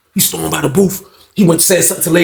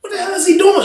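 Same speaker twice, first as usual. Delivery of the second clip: much higher baffled exclaim; amazed cry; 10 semitones higher than usual. Retold or imitated. Inmates watching the fight, amazed. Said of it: "we like".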